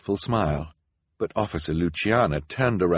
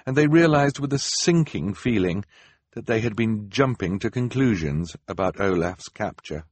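usual speaking voice

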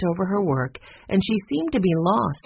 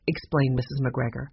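ordinary delivery, nothing marked

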